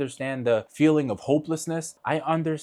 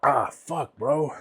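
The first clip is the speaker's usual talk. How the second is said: holding back tears; 4 semitones lower, slower than usual. Real, not acted. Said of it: "Stop crying".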